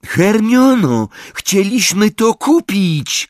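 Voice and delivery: high, drawn-out outcry; loud, high wail of outrage; indignant shout. A narrator's reading. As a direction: cry out in protest. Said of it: "krzyknął Ron".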